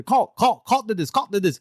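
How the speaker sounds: high, loud, insistent repeating; over-trained witness. Acted. Coached witness repeating his accusation to the questioner, over-eager. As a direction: parrot the accusation, hammed-up. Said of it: "repeating the same thing".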